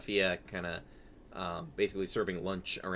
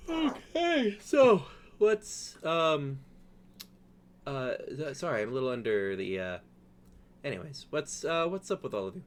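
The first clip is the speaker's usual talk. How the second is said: high, faltering character voice; flustered host losing her thread; Safia, tired and flustered; 5 semitones higher than usual. Acted. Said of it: "She's visibly tired".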